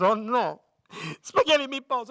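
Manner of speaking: put-on loud, high Italian-accented horror; hammed-up Italian dismay, building; Italian worker, horrified. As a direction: cry out in despair with an Italian accent. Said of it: "Finally, the Italian's shaking up his lunchbox".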